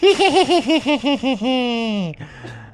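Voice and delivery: high, loud cheeky cackle; monkey laughing at its trick. Acted. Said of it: "the monkey started to laugh".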